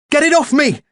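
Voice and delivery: very high, loud panic; terrified yell. A narrator's reading. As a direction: yell as the beak jabs. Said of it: "I yelled".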